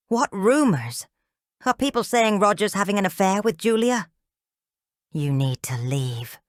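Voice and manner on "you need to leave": slow, low, firm warning; cold warning. A narrator's reading. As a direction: lay down the line slowly. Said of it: "glaring at Darren".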